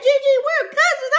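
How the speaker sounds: overjoyed cry; excited squeal. Retold or imitated. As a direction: squeal the big news. Retold joyful discovery.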